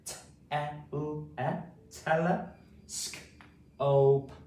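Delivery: T, e, l, e, then tele, then sk, o, p; sounded out. Shown as a demonstration. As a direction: segment the sounds, blending the first half into tele. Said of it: "So let's say it again".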